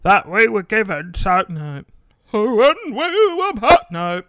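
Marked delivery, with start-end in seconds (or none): 0.0-1.4 loud put-on old dad voice; dad's voice. Imitating dad mid-story, put-on loud voice. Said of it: "I'll do it in his voice". 2.3-3.8 high, slow, loud put-on old man. Trying out an old man voice for dad's quote. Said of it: "How do you do an old man?".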